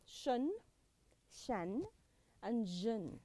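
shun, shan, jun each said as a separate model sound; suffix sounds. Shown as a demonstration. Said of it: "Three of these suffixes are".

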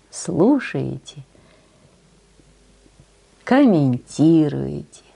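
usual speaking voice

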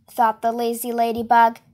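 usual speaking voice

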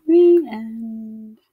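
put-on gentle read-aloud voice for young children; storybook narration. A narrator's reading. Teacher reading a picture book to her class, gentle storytelling. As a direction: tell it like a bedtime picture book.

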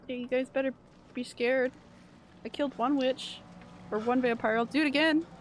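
usual speaking voice

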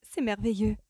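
delighted exclamation. A narrator's reading. Dancer whose feet suddenly know the steps, exclaiming. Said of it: "s'écria-t-elle".

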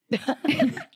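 short, bright burst of real laughter. Real, not acted. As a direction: laugh it off, a bit embarrassed. Laughs at the quote.

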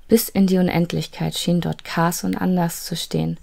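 usual speaking voice

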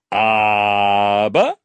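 low, drawn-out mocking "Aber" with a rising end; King mocks the boy by stretching "Aber" low and rising. Mocking the boy's "Aber", the King drags it out low. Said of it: "spottete er".

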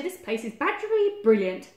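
loud, mildly gleeful boast; delighted Badger. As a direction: declare it proudly and loudly. Badger delights in his wall.

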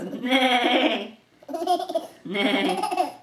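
drawn-out, quavering whinny; horse neigh. Acted. Parent showing what the horse goes, long whinnying neigh. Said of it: "It's a horse. It goes".

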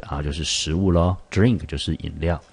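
normal talking voice

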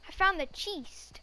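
ordinary delivery

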